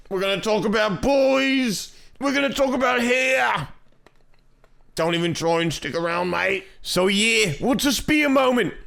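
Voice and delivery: high-pitched, hammed-up Australian accent; Mia. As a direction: lay on a bad, high Aussie accent. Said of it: "the shitty Australian accent".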